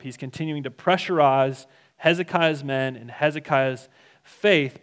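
normal talking voice

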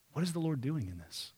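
hushed, inward asking; soft question. Acted. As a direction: murmur the question quietly first. Repeated key question, soft take.

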